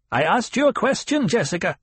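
put-on high, sharp old woman's snap; snappish, demanding. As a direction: snap at her to pay attention. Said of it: "The old woman's voice was snappish, demanding".

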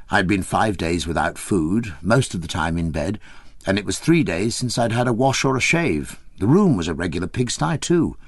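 ordinary delivery

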